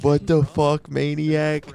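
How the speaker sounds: high, loud starstruck outburst; drunk excited fan. Retold or imitated. Drunk fan barges up to his idol, imitated loud gushing. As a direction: blurt it like a wasted superfan. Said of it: "he was fucked up".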